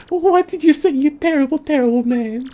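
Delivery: high indignant falsetto; outraged daughter. Acted. Daughter scolds the man who refused her, high indignant voice.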